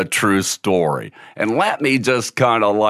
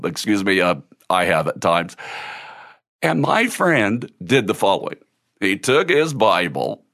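ordinary talk, nothing marked

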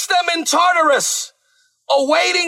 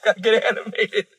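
laughing; 7 semitones lower, faster than usual. Real, not acted. Preacher apologizing for getting carried away, laughing. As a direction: chuckle through the apology.

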